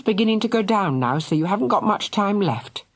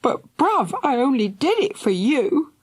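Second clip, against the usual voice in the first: higher, pleading through tears; tearful plea; 11 semitones higher than usual. A narrator's reading. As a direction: tell him you meant well, near tears. Tearful sister.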